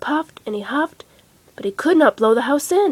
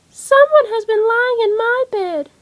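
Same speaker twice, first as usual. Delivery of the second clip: put-on little baby voice; 12 semitones higher, 6 dB louder than usual. A narrator's reading. Baby Bear cries over his chair, high baby voice. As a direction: squeak it out like a tiny cub. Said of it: "said Baby Bear in a little baby voice".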